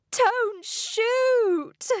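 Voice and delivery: very high, pleading cry; panicked shout. A narrator's reading. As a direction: beg for mercy at high pitch. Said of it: "Mister and Missus Gregg shouted".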